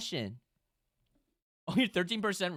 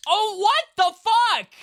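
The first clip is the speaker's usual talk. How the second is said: much higher, louder, slow stunned shout; shocked yell; 15 dB louder, slower than usual. Real, not acted. Streamer reacting to a wild game result, stunned yell. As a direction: scream in disbelief.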